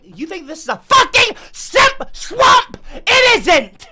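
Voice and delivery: high, loud tantrum at the viewers; outraged shouting. Acted. Streamer tells viewers off over Simp Swamp, outraged shouting. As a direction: blow up and yell in exasperation. Mock rant denying the swamp name.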